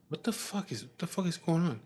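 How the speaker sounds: mock startled. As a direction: lightly imitate a girl's alarmed question. Startled girl's protest retold.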